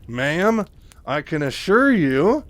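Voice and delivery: strained, brittle politeness, slow; through gritted teeth. Retold or imitated. Lieutenant to Kerrigan, brittle forced-polite through the teeth. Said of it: "talking through her teeth".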